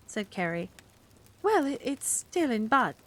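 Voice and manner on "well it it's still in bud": slow, halting reluctance; stall, not wanting to show it; unwilling. Acted. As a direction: Miss Price reluctant with Carrie, hesitant and slow. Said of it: "said Miss Price unwillingly".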